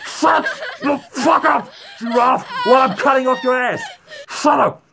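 high, fast pretend-angry scolding; mock furious command. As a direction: scold over the victim's screams in mock fury. Scolds pretend screaming giraffe.

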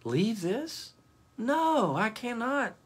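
high, breathless gasp of dismay; gasping in shock. Acted. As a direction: gasp it out, horrified at leaving it. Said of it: "Gaspar gasped".

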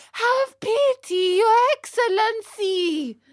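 very high, loud begging; imploring boy puppet at full pitch; desperate puppet plea. Acted. Pinocchio begs a third time.

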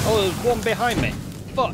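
startled alarm mid-chase; being chased. Real, not acted. Monster behind while fleeing.

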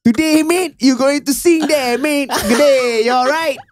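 hammed-up Aussie small talk; fake Australian accent. Malay hosts faking an Australian accent for [name]. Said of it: "speak with a fake Australian accent".

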